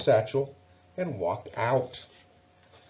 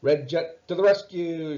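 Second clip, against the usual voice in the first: a bold, louder playful rallying cry; shouting; 6 semitones higher, 5 dB louder than usual. A narrator's reading. Mouse playing fire chief shouting his rallying cry. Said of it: "shouted Fire Chief Morris".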